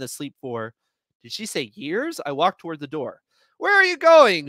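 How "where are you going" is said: high, loud call after someone. A narrator's reading. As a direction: call out to stop her leaving. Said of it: "she calls".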